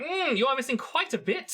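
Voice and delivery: high-pitched fussy appraiser; merchant character. Acted. Merchant character appraising damaged goods.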